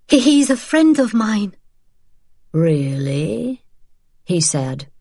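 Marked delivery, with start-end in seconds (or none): 0.1-1.6 frightened. Acted. Frightened woman faces Gestapo. 2.5-3.6 a long, doubting really; drawn-out really. Acted. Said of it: "drawing length from the word".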